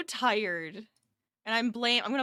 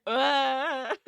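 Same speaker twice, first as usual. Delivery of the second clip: drawn-out tired groan; a weary, mock-pained ugh; 4 semitones higher, 6 dB louder than usual. After late podcast nights, a tired groan. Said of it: "and it's just like".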